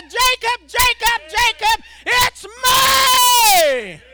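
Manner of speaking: loud high repeated call; God calling out. Acted. God calling Jacob by name, shouted.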